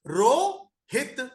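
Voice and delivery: very slow रोहित with a rise; sounding out रोहित. Shown as a demonstration. Teacher slowly sounds out रोहित. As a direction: split रोहित into रो and हित. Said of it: "अब रो भी अच्छा sound नहीं है".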